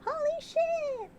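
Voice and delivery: high, drawn-out cry of shock; real surprise, pitched high; startled exclamation. Real, not acted. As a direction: yelp it out, caught off guard. Sudden reveal in game.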